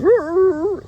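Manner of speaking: high excited dog woofs; yappy little dog roos; dog barking. Acted. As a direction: bark like an eager puppy. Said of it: "And so Barney goes".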